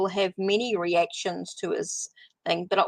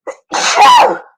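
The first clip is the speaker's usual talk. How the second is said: loud sudden sneeze; a real, explosive sneeze; sneezing; 15 dB louder than usual. Real, not acted. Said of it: "Sorry, I'm gonna sneeze".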